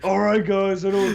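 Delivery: high, loud mock buddy voice; hammed-up impression of a pal; impression of friend. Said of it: "That's my impression of you".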